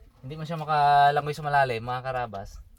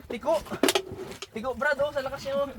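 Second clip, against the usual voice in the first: excited; 8 semitones higher, 4 dB quieter than usual. Real, not acted. Fish pulling hard underwater.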